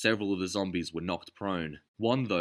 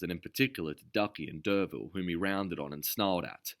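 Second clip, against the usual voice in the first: usual speaking voice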